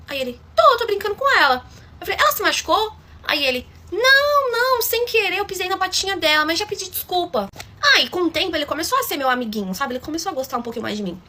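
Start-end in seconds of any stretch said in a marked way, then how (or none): 0.6-1.6 menino agudo, mais alto; voz de criança imitada. Retold or imitated. 2.2-2.9 pergunta aguda e aflita; preocupada. Retold or imitated. 3.9-7.5 menino bem agudo se defendendo; voz de criança imitada. Retold or imitated.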